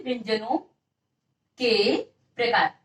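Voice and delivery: word by word, with pauses between; dictating a heading. Real, not acted. Teacher has students write the heading, dictating slowly in pieces. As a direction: dictate it in pieces for note-takers. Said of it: "तो आप लोग heading बना लीजिए".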